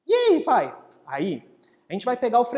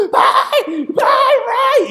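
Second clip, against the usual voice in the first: put-on high, loud calling kid; child shouting; 12 dB louder, much slower than usual. Boy shouting for his father, high and loud. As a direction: call out to dad at full voice. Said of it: "começa a gritar de novo".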